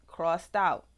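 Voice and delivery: ordinary delivery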